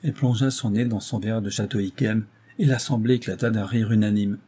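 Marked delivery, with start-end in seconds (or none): none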